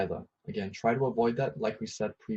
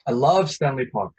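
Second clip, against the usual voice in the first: louder, gushing enthusiasm; loud excited exclamation; 3 semitones higher, 8 dB louder than usual. Shown as a demonstration. Teacher showing how an exclamation mark sounds, excited. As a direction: say it like you can't contain your love for it. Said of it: "if we're very, uh, excited".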